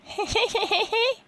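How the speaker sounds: high cackling witch laugh; witch cackle. Acted. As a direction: cackle like an old hag. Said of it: "let's start with a witch laugh".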